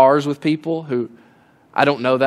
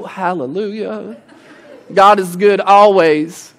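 mock Sunday piety; 2 semitones higher, slower than usual. Mocking Sunday churchgoer.

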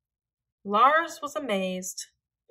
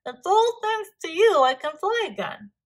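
high, loud, delighted bird; happy; 8 semitones higher, 5 dB louder than usual. A narrator's reading. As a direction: thank a friend with glee. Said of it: "laughing happily".

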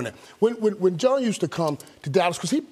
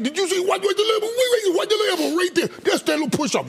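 much higher, excited on-air call; imitating a Madden broadcast; 13 semitones higher, faster than usual. Madden exposing the receiver's push-off on air, excited and high. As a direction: break down the replay like a thrilled analyst. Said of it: "as soon as I did".

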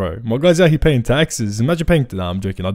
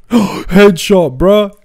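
higher and louder, a sudden burst of hype; hyped headshot shout; 7 semitones higher, 6 dB louder, much slower than usual. Real, not acted. Gamer yelling at his screen after a one-bullet headshot, excited. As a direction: yell it like you just landed the shot.

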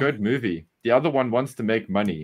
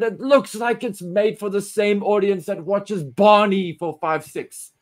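high, slow, mocking; 6 semitones higher, slower than usual. Real, not acted. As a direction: sneer at it. Mocking kids' show.

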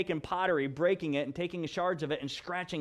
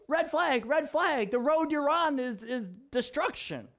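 high, urgent prophet's alarm; prophet's warning; 8 semitones higher than usual. Acted. Prophet warning people off their road, urgent and high. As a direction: sound the alarm, pitched well up.

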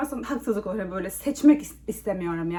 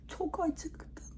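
whisper; 7 semitones higher, 14 dB quieter than usual. Real, not acted. Whispering hunger.

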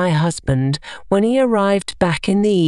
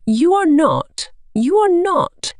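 rising, higher cry building on the repeat; 9 semitones higher than usual. A narrator's reading. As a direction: pitch climbs high as she cries it out twice. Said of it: "she cried".

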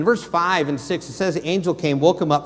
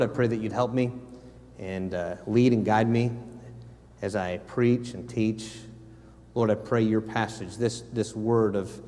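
ordinary talk

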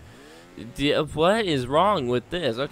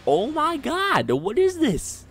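high frustrated whine; 4 dB louder, much slower than usual. Real, not acted. Raging at the level.

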